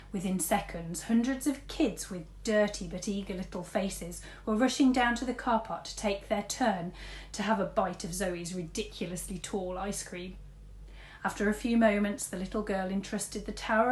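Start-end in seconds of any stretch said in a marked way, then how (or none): none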